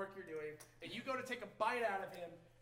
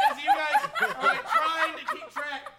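high, loud narration through laughter; laughing narration; 15 dB louder, slower than usual. Real, not acted. Game master describing the chaos, laughing loudly. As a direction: narrate while cracking up.

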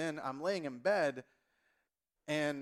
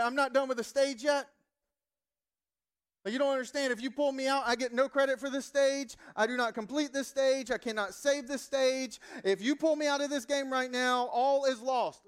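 put-on whiny gamer teen; 7 semitones higher, 6 dB louder than usual. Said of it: "And you're like".

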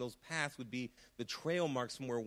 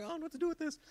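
bewildered; 13 semitones higher, much faster than usual. Pretend. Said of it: "and you're like".

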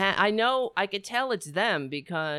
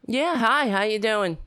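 to someone hard of hearing; 5 semitones higher, 5 dB louder than usual. Real, not acted. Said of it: "Can you speak up, please?".

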